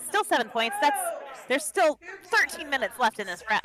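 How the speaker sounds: normal talking voice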